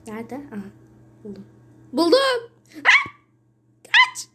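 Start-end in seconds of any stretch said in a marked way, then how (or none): 1.9-4.3 high, loud shriek of panic; panicked scream. Real, not acted. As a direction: scream as the killer appears. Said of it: "az önceki sesi de güzel çıkardım". (panik içinde yüksek çığlık)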